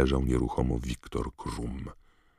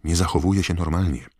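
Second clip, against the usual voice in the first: raised, higher voice; urgent Harry; 4 semitones higher, 6 dB louder than usual. A narrator's reading. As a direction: report it with alarm. Harry hurrying, reporting alarming news. (przejęty, podniesiony głos)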